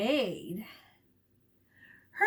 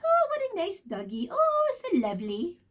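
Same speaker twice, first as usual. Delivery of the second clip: mock lady voice; 6 semitones higher than usual. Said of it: "this lady ... says".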